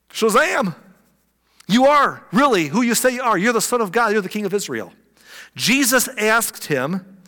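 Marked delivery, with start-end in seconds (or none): 0.1-4.9 high-pitched excited exclamation; amazed conversion. Acted. Nathanael now convinced about Jesus, amazed. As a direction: burst out as a stunned new believer. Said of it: "Now he's like".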